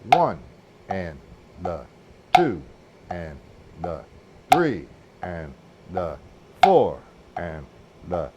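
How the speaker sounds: detached syllables evenly spaced on the beat; rhythmic counting. Shown as a demonstration. Drum teacher in position to nail the accent, accented rhythmic counting. Counts along while demonstrating strokes.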